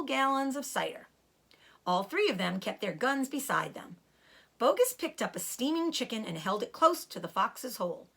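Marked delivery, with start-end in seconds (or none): none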